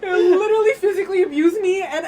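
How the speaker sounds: loud, high exclamation; heated venting. Real, not acted. Comparing the ex to worse people, heated venting. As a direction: hammer out the grievance at high pitch.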